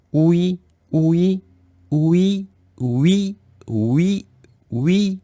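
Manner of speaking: oui gliding upward from ou to i, repeated; repeated vowel drill. Shown as a demonstration. Teacher drilling the blended vowel for learners, repeated glides. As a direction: chant oui as separate rising glides.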